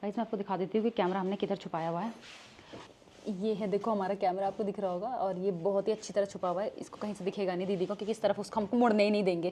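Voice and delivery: low, soft aside to the camera; hushed secret update. Real, not acted. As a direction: keep your voice down so nobody overhears. Showing the hidden camera secretly.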